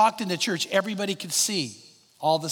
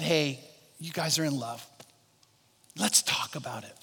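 gentle pastor; 4 semitones lower, 6 dB quieter than usual. Retold or imitated.

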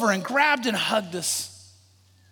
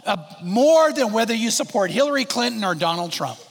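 normal talking voice

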